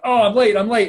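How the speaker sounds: playful imitation of a chatty friend suddenly late; flustered hurry. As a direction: blurt it out like you just noticed the clock. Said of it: "And then you're like".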